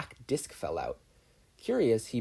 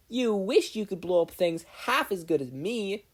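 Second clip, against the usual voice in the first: higher snappy comeback in a different voice; Mei's cocky retort, a higher voice; shot back; 8 semitones higher than usual. A narrator's reading. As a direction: fire it back smugly. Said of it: "Mei shot back".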